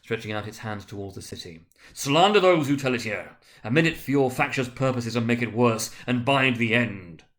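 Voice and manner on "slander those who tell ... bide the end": loud, stern ghostly warning; crying out. A narrator's reading. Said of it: "cried the spirit".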